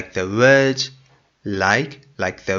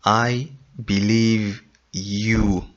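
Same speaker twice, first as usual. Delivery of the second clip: correct form, slow; slower than usual. Shown as a demonstration. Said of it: "You say".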